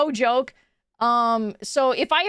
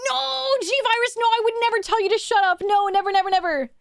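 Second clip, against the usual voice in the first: mock-innocent; 8 semitones higher than usual. Streamer teasing a chatter, mock-innocent high sweet denial.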